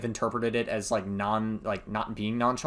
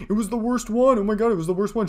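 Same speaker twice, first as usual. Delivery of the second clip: high, whiny mock of her gushing; mocking quote; 8 semitones higher, faster than usual. Streamer mocking a woman's claim, whiny high voice. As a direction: parody her overblown claim.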